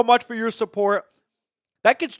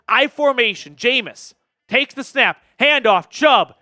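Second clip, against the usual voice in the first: play-by-play call; 2 semitones higher, 5 dB louder than usual. Real, not acted.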